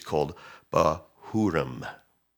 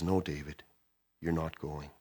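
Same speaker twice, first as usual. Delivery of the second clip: softer, gentle but firm no; soft divine refusal; 2 semitones lower, 10 dB quieter than usual. Retold or imitated. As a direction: lay down God's answer quietly. God forbids David, softly.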